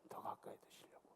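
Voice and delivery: breathy whispered repeat; whispered prayer phrase, no voice. Acted. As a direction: drop it to a bare whisper.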